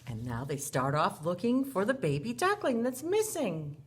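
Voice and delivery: ordinary delivery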